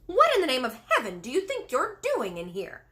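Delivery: a loud, scolding yell at an intruder; angry shout. A narrator's reading. Maid shouts angrily at the girl on the windowsill. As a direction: bark it at the stranger. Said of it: "she shouted angrily to Sophie".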